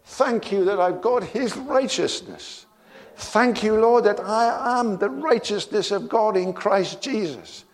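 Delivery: high, lifted voice praising Father in thanks; joyful morning prayer. Shown as a demonstration. Believer thanking the Father each morning, high joyful prayer. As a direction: pray it out high and glad, like waking up thankful. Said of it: "you wake up every morning and say".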